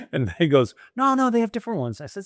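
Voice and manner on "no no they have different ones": high, hurried broker's reassurance; quick retold protest. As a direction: jump in to correct, pitched up and fast. Broker retold, hurrying to correct him.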